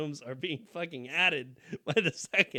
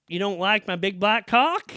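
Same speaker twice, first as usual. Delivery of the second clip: mom's voice; 5 semitones higher, 11 dB louder than usual. Retold or imitated. Mom voiced in story.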